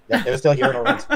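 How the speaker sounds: high, laughing cartoon goof; dopey high voice through a laugh; Goofy voice. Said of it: "Exactly like Goofy".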